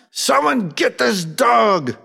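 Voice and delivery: high, drawn-out panicked yell; retold yell. Police partner calling for help with a huge dog, yelling. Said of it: "my partner yelled out".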